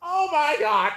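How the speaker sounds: high, thin gasped-out shock; high-pitched shocked reaction; stunned disbelief. Real, not acted. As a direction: squeak it out high, astonished. Stunned by the battle.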